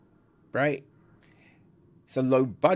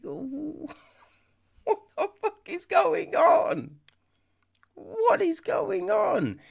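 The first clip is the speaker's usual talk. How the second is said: very high, slow incredulous cry; high-pitched baffled squeal; hammed-up bewilderment; slower than usual. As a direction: stretch it out in mock disbelief. Acting out baffled viewer's reaction.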